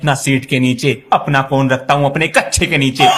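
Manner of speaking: normal talking voice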